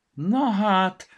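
high, loud wondering exclamation; high astonished little voice; amazed. Acted. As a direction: marvel out loud. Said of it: "ámuldozott Vaksi".

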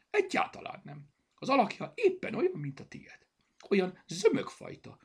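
animated, high and loud; livened up. A narrator's reading. Zorka compares Berta's shape to the bear, animatedly. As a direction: describe it eagerly, pitch leaping up. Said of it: "folytatta felélénkülve".

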